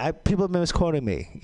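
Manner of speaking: usual speaking voice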